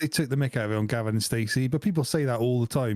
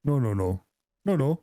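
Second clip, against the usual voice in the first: Welsh dad's no no; slower than usual. Retold or imitated. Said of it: "my dad does it".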